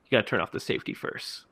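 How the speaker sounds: whispered, fast and low; whispered line. Retold or imitated. Billy taunting Gail, mock whisper. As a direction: breathe it out quickly without voice. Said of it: "he says".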